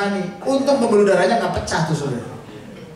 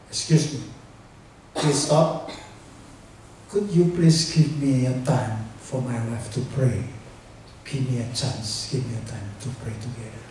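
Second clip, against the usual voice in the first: soft, low retold request; polite plea; 8 semitones lower, 7 dB quieter than usual. Husband asking doctor for time to pray, soft and low. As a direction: ask quietly and humbly for time.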